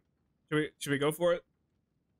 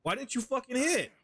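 high-pitched, exasperated demand; annoyed berating; 7 semitones higher than usual. Real, not acted. Streamer berating a player for not hitting, annoyed. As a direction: chew them out in disbelief.

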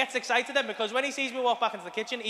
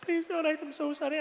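tearful pleading; 5 semitones higher than usual. Retold or imitated. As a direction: beg the child, mock-tearful. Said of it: "I'm crying my eyes out".

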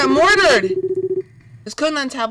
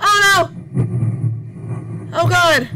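alarmed; 7 semitones higher than usual. Real, not acted. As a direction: let the fear jump out. Scared by breathing noise.

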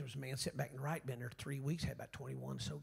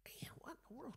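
hushed aside; a preacher muttering under his breath in surprise; puzzled whisper; 10 semitones higher, 15 dB quieter than usual. Retold or imitated. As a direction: whisper it to yourself in disbelief. Private puzzled thought at the altar.

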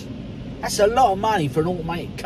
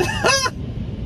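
high burst of laughter. Real, not acted. Laughing at car wash scare.

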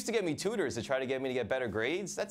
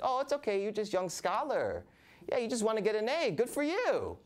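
high, soothing mom voice; 10 semitones higher than usual. Retold or imitated. Mother consoling her son about girlfriends, high cooing voice. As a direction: coo at him like a mother.

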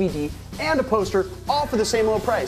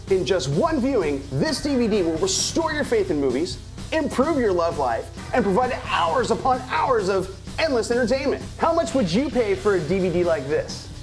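ordinary talk

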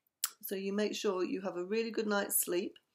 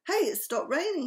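higher, louder storybook call; excited cry; 5 semitones higher, 5 dB louder than usual. A narrator's reading. Buster tells friends the rain has stopped, crying out. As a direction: call out the news, lightly excited. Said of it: "he cried".

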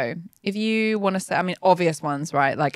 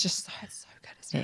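breathy whisper of approval; hushed gushing; 14 dB quieter, faster than usual. Real, not acted. Fan gushing to a fellow fan about a show, hushed. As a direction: drop to a whisper, confiding.

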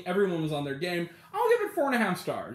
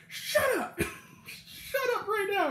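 high, squealed telling-off at no great volume; retold berating through laughter, pitched way up; mock berating; 13 semitones higher, slower than usual. Guard berating two old hecklers, high mock scolding. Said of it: "constantly berating the two old men".

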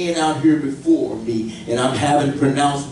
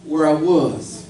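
high, rising preacher's climb; full-force preaching; 10 semitones higher than usual. Acted. Pastor preaching to his church, high and fervent. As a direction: lift the congregation higher and higher.

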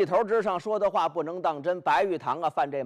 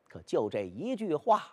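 plain reading voice

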